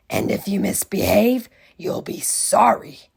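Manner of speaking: loud breathy witchy threat; menacing threat. Acted. Miss Swamp warning the class, loud breathy menace. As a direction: threaten them with relish. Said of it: "said Miss Swamp".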